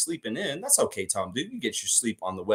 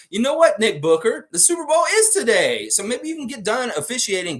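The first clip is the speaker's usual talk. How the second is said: teasing; 11 semitones higher, 5 dB louder than usual. Real, not acted. Teasing the ref.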